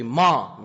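ما hit short and sharp, dropping; abrupt falling ما with a hard attack; struck tone. Shown as a demonstration. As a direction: punch out ما in one quick stroke. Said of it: "یه جا هم ضربه بگی".

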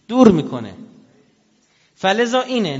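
sudden loud preacher's cry; preaching shout. Real, not acted. Preacher to congregation, sudden loud shout. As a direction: hammer the point home to the crowd. Preacher driving a point home.

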